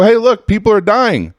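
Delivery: the government faking outrage to justify going in; mock alarm. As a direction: cry out a hammed-up humanitarian alarm. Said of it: "We go".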